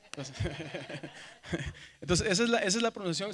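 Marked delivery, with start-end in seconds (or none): 0.4-2.0 risa baja y suave entre dientes; ríete bajito un momento. Real, not acted. Se ríe tras la práctica. (risita grave y entrecortada)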